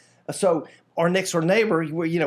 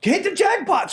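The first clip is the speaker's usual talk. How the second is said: excited; 13 semitones higher than usual. Real, not acted. Agreeing he hit the jackpot, excited. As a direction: crow it like a winner.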